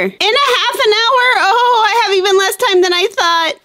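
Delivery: high-pitched, alarmed whine; panicked wail. Acted. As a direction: cry out in rising dismay.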